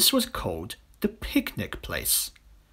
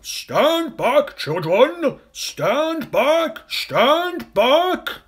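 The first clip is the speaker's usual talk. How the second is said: much higher, louder alarmed elephant cry; an urgent elephant shout; elephant's warning shout; 12 semitones higher, 7 dB louder, much slower than usual. Acted. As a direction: yell the warning to save them. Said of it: "a big, deep voice from the jungle shouted".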